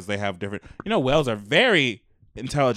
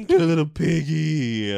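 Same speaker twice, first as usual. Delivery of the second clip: higher-pitched cooing; pet baby talk; 4 semitones higher than usual. Pretend. Fussing over a little piggy, baby talk. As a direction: draw it out as if doting on a pet.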